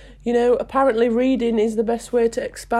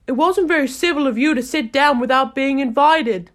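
March Hare retort; 5 semitones higher, 5 dB louder than usual. A narrator's reading.

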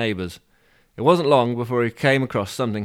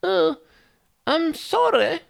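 put-on very high posh old lady; old woman; slower than usual. Reader trying an old woman's apology, high and posh. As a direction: apologise in a piping falsetto. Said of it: "how would an old woman say".

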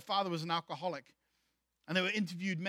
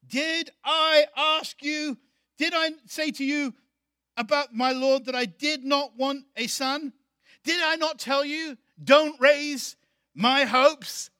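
higher, louder, slow rising indignation; mad woman; 6 semitones higher, 8 dB louder, slower than usual. Acted. Shunammite woman confronting the prophet over her son, angry and loud. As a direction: confront him, wounded and furious. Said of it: "This woman's mad now".

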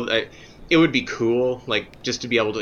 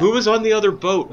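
pestering fan; 11 semitones higher, 5 dB louder than usual. Retold or imitated. Quoting fans, then the annoyed actor.

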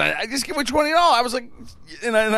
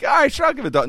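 mock customer offer; 12 semitones higher, 5 dB louder, much faster than usual.

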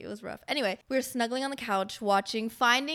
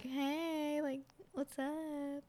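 much softer, slow, tender ask; gentle coaxing; 5 semitones higher, 11 dB quieter, much slower than usual. Retold or imitated. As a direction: coax him softly like a nervous kid. Gently asking nervous boyfriend.

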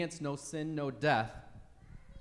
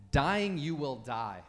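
Dying you will die, slow and deliberate, Dying stressed; slow weighty phrasing; 4 dB louder, slower than usual. Shown as a demonstration. Preacher giving the literal Hebrew, slow and solemn. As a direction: weigh every word of the verdict. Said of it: "Again, for emphasis".

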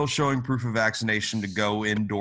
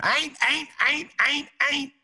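high-pitched hollering hillbilly chant; hillbilly screaming. Retold or imitated. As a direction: shriek it like backwoods raiders. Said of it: "you're just gonna hear a bunch of 'em screaming".

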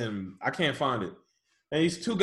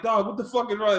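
retold angry yell; 7 semitones higher, 3 dB louder than usual. And I was like.

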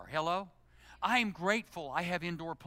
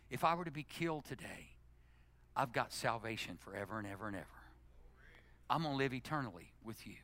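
normal talking voice

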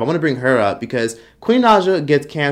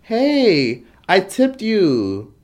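smug tipper; 6 semitones higher, much slower than usual. Retold or imitated. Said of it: "they say".

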